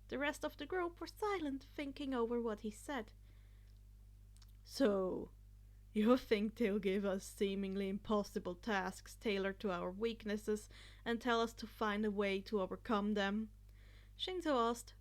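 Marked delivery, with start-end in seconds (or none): 4.6-13.5 put-on lower, steady boy's voice; a lower, level classmate asking a question; calm classmate. A narrator's reading. Shinzo sums up Izuku's theory, lower and steady.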